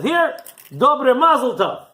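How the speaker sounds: loud, high, drawn-out congratulation; booming generous nobleman; grand Baron. Acted. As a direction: proclaim it as you hand over a fortune. Baron hands over money grandly.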